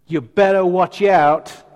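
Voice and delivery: loud, slow mock warning; thundering preacher. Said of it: "heard a preacher thundering".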